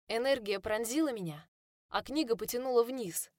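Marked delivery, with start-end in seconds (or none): none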